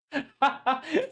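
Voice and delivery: squealing laugh burst; amused high giggle. Real, not acted. Laughs after joke.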